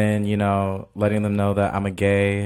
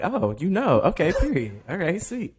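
flustered reply; 7 semitones higher than usual. Retold or imitated. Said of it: "I'm like".

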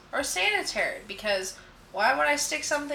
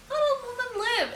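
mock inner voice; 11 semitones higher, 4 dB quieter than usual. Cashier's inner voice at popsicles.

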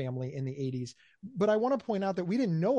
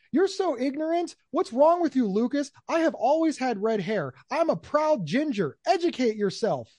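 much higher and louder indignant yelling; hammed-up online outrage; 8 semitones higher, 6 dB louder than usual. Shown as a demonstration. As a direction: play the furious internet commenter. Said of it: "the American way".

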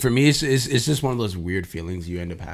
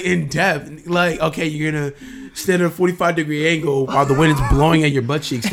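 mock explaining; 8 semitones higher, 4 dB louder than usual. Over-explaining quote, higher, louder.

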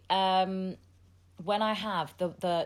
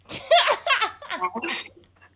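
loud high laugh; 11 dB louder than usual. Real, not acted. After telling rude questioners to shut up, loud high laughter.

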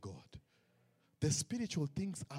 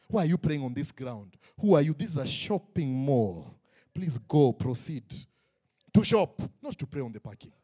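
louder mock guard order; 8 dB louder than usual. Mall guards ordering the praying pastors to leave, mock loud. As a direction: shoo them off the mall ground.

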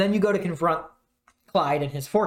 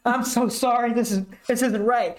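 higher-pitched mock apology in character; apologetic Chef impression; 7 semitones higher than usual. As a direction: apologize as a hammed-up cartoon cook. Chef apologizing in lucid moment.

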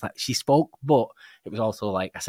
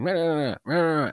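imitating child's noises; 6 semitones higher than usual. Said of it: "So she's going".

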